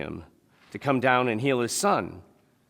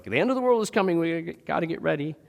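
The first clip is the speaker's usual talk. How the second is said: high-pitched, mock doomsday warning; alarmed prepper; 6 semitones higher, 3 dB louder, faster than usual. Prepper warning the world is ending, mock alarm. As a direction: urge readiness in a raised, panicky imitation. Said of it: "if any of you guys are preppers".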